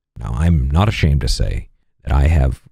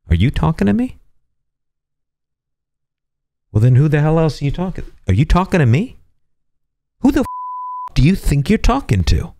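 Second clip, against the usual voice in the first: belligerent challenge; 11 semitones higher, faster than usual. Acted. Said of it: "I will now demonstrate pugnacious".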